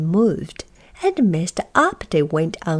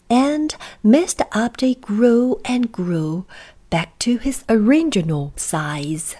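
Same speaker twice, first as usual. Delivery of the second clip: ordinary delivery, nothing marked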